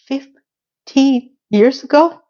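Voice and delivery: slow, drawn-out disbelieving rise; stunned slow repeat of fifteen years ago; shocked echo. Retold or imitated. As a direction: stretch it out in disbelief. Shocked echo of his line.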